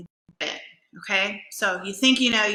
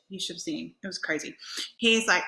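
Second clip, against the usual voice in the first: ordinary talk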